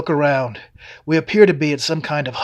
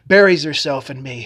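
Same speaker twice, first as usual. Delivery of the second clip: plain reading voice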